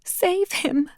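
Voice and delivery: high, softly sobbing plea; weeping girl pleading with her panda; soft sobs. Acted. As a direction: beg through tears. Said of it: "she repeated in soft sobs".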